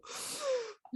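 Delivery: big theatrical high gasp; dramatic gasp. Retold or imitated. Mother imitating her daughter's entrance, a theatrical gasp. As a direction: gasp like the world is ending.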